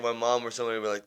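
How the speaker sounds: ordinary delivery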